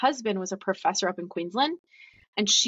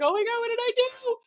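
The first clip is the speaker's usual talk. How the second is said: very high, wailing panic; mock crying. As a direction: act out a tearful meltdown. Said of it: "I just started crying".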